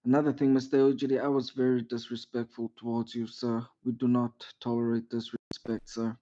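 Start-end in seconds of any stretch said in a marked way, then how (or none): none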